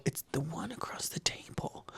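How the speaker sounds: barely voiced, secretive whisper; mouthed whisper. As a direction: mouth it silently like gossip across a table. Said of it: "I just saw her mouth go".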